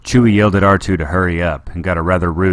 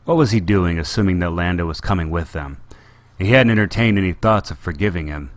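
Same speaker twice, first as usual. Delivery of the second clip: usual speaking voice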